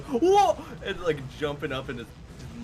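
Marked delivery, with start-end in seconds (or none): 0.0-0.6 much higher, louder startled shout; startled yelp. Retold or imitated. Picturing Tab attacked by a spider, startled yelp. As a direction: jump out of your chair with a yelp. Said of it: "picture Tab being like".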